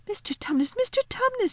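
put-on high, alarmed little girl; great distress. A narrator's reading. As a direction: call his name anxiously, rising on the repeat. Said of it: "said Lucy in great distress".

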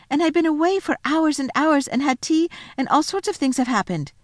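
put-on high, eager child's telling; excited insistence. Lucy telling disbelieving siblings of her adventure, excited.

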